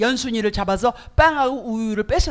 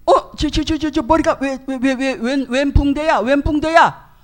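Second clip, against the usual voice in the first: shocked stammer; 4 semitones higher, 6 dB louder than usual. Retold or imitated. Father shocked at bandage.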